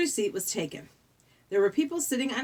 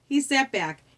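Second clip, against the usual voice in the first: usual speaking voice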